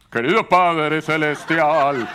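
loud, drawn-out mock prayer; put-on prayer voice. Preacher mimicking those who change voice to pray, booming mock-solemn prayer voice. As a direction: boom it slowly like an over-solemn person praying. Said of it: "cambiar así la voz".

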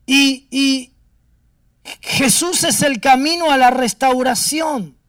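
a full-voiced climax of the sermon; loud proclamation. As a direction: proclaim it loudly to the congregation.

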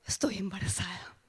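shocked hushed whisper; a stunned whispered "Estoy embarazada". Retold or imitated. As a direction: breathe it out in disbelief. Whispered realization after gasp.

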